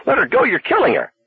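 very high, frantic yell; frantic shout. A narrator's reading. Arnie screaming at the hitchhiker to release Lee, frantic shout. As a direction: scream to save her life. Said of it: "Arnie was shouting".

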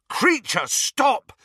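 put-on boy shouting at an elf to stop beating himself. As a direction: shout him down, harder each time. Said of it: "shouted Harry".

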